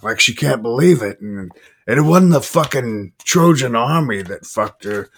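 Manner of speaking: boastful movie-devil monologue, hammed up; Al Pacino. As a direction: stretch it out, rising high on the punchline. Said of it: "Al Pacino talks about".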